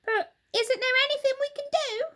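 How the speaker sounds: high, fast, frantic; Mrs Twit panicking. Acted. Terrified wife begs her husband, high and rushed. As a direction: rush it in panic. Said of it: "cried Missus Twit".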